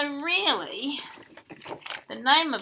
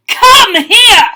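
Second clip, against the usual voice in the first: giant's roar, much louder and higher; 11 semitones higher, 23 dB louder than usual. Acted. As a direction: bellow it with full force, angry giant. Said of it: "he roared".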